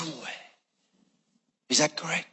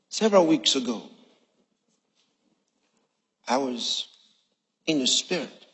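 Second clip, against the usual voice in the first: ordinary talk, nothing marked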